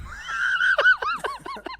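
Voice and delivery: very high shouted laughter; shrieking laugh. Real, not acted. Hearing Rodney's mugging idea, a shrieking laugh. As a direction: burst out at the absurd plan.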